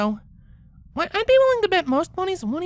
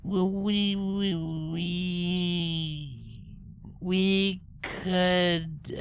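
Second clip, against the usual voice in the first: low, halting, reading it out slowly; a hint of a grumpy pony stumbling over words; Rainbow's mock sullen voice; 8 semitones lower, much slower than usual. A narrator's reading. Rainbow reading to herself, mildly sullen and halting. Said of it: "sullenly speaking to her own reflex".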